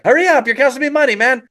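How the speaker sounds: high, fast, pressing complaint; impatient hurrying. Acted. Rickshaw driver losing money, fast impatient nagging. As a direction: rush the customer, high and quick.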